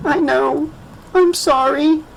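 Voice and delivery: put-on high, small child voice; a sorry little girl. A narrator's reading.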